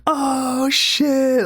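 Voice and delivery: high, drawn-out "oh" of a scandalized crowd; hammed-up high-pitched astonishment; mock crowd reaction. Retold crowd reaction to a steal.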